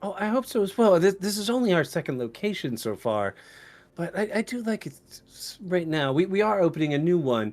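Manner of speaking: high-voiced, slightly halting elf woman; mousy, calm librarian. Librarian chats about her new location, mousy and high. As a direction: keep it pitched high, mousy and polite. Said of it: "very mousy".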